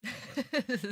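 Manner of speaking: loud high laugh; burst of laughter. Real, not acted. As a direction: let out a big laugh. Laughs at viewer comment.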